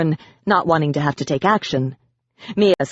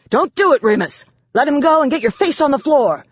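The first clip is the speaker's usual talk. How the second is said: shouting command; 9 semitones higher than usual. A narrator's reading. Officer shouting orders at the hostage-taker. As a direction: bark it across the court. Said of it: "Eden shouted".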